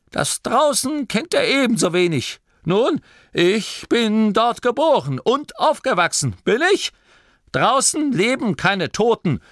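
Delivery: put-on indignant old gnome grumbling; scandalised grandmother; old granny voice. Oma Morky and Torrid voiced.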